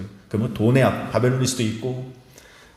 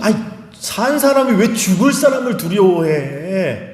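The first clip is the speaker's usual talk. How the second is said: higher, slower, disbelieving challenge; God asking, higher and slow, why they fear at all; incredulous rebuke; 6 semitones higher, slower than usual. Shown as a demonstration. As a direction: ask it like the fear makes no sense. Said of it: "이 뉘앙스가 뭐냐면 이거예요".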